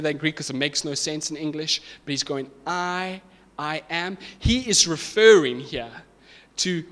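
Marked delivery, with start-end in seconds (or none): 2.7-4.2 "I, I am" with a held first I and a pause; emphatic I AM. Shown as a demonstration. Preacher explaining the Greek, "I, I am" emphatic with the I held. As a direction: hold "I", pause, then say "I am". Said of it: "he's been emphatic".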